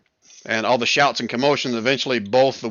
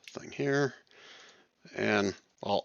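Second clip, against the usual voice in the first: normal talking voice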